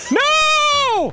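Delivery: high, anguished "no"; real yell. Real, not acted. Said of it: "Sorry I yelled like that".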